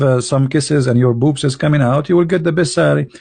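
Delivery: normal talking voice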